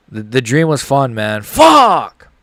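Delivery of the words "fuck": a real, much louder angry yell; furious shout. Real, not acted. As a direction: let it burst out at full volume. Reaction to the knockout.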